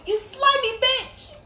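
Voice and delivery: high, loud, slow insult yelled; outraged scream; furious outburst. Real, not acted. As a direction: yell it at the attacker. Enemy grabs her in game.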